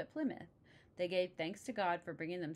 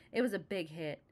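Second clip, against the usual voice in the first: ordinary delivery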